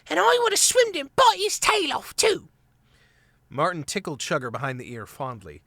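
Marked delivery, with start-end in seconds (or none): 0.1-2.5 put-on very high tiny child voice; truculent little squirrel. A narrator's reading. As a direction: boast defiantly like a toddler. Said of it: "thrust out his little jaw truculently".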